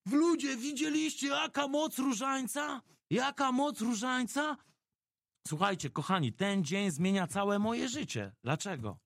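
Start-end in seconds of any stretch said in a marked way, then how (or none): 0.1-4.6 high, slow, triumphant shout; excited shout. Retold or imitated. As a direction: proclaim it to the whole bus. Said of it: "Amadeusz krzyczy".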